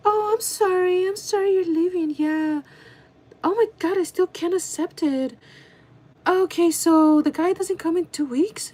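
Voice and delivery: high, put-on coworker voice; fake sympathy. Coworker sorry that she's leaving, fake sympathy. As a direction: imitate her hammed-up fake concern, pitched high. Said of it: "That was the fakest reaction".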